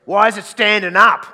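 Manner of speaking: loud, high, exasperated complaint; older guy. Acted. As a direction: blurt it out in loud disbelief. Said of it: "They go".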